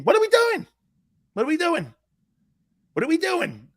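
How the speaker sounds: high-pitched imitation of a teammate hollering; high, punchy mock holler; teammate's excited call. Retelling teammate's loud bouncy entrance.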